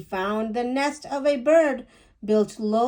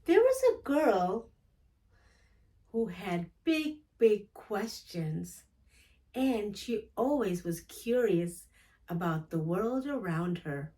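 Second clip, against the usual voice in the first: quiet retelling; 10 dB quieter than usual. Real, not acted. A reader softly recapping the girl's story afterwards, hushed. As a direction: murmur the story back to yourself.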